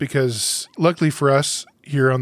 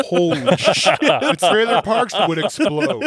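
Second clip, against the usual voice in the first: laughing; 3 semitones higher, slower than usual. Real, not acted. Laughing at redneck joke.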